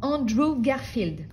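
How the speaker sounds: loud, high, slow name reveal; triumphant announcement. Real, not acted. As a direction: announce it like a prize. Triumphantly recalls the actor's name.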